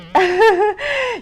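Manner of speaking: bright squeal of a laugh; high burst of laughter. Real, not acted. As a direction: laugh out high at own mistake. Laughs at own slip of tongue.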